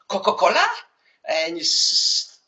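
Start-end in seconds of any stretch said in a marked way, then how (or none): 0.1-0.7 high, loud bewildered guess; a sailor guessing what you want; puzzled sailor. Retold or imitated. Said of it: "look at you and say".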